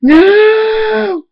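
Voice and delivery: piercingly high, long wail; screaming. A narrator's reading. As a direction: scream it out in grief, holding the vowel. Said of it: "Scootaloo screams".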